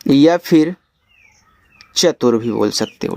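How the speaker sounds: ordinary delivery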